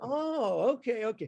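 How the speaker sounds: high, lightly voiced dad backing off; a hint of a father shrugging it off; dad giving in. Father to son after he backpedals, lightly mimicked. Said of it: "He goes".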